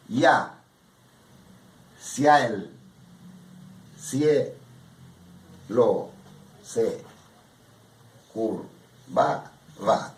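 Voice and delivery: each beat said apart with pauses; ya, ciel, cielo, se, curva, va counted as separate beats; syllable by syllable. Shown as a demonstration. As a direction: tap out every metric beat separately. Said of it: "son golpes de voz".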